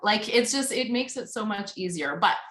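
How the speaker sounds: usual speaking voice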